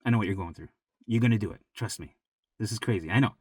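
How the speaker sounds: very low, soft and soothing; hushed, sincere comfort; quiet reassurance. Real, not acted. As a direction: reassure them softly, as a confidant. Quietly reassuring struggling viewers.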